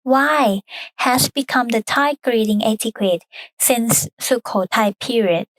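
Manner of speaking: normal talking voice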